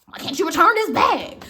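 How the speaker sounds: loud, pushy retold demand; mock aggressive customer. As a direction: play the rude customer snapping at the clerk. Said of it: "that's what she was giving".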